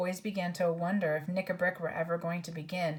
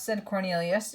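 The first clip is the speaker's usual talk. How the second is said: plain reading voice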